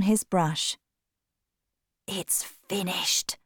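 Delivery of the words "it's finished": soft, breathy whisper, slowly. A narrator's reading. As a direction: breathe the words out in awe. Painter quietly announces finished portrait.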